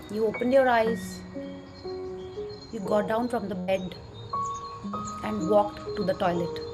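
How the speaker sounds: soft, slow meditation voice; calmly walk them through gratitude; guided meditation. Acted.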